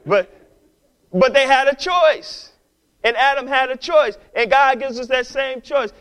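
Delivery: loud, high full-force preaching. Acted. Preacher telling the congregation they had a choice, preaching at full force.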